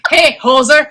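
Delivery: put-on Canadian accent. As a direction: call it out like a hockey fan from Canada. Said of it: "Oh, Canadian".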